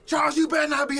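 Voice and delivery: mock yell from upstairs, high and loud; retelling his wife calling him out, hammed-up yell. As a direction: shout his name like an angry wife. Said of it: "And all I can hear".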